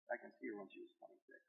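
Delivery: a private whisper to himself; whispered memory. Real, not acted.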